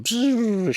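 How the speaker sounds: high buzzing spaceship whoosh; imitated ship noise. Voice actor filling in ship noises, high buzz.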